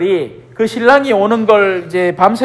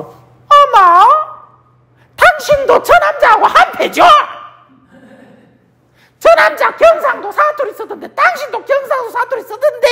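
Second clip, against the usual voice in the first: village woman accusing. Retold or imitated. Woman by the fire accuses Peter, high shrieking mock voice. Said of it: "옆에 웬 아낙네가 와갖고".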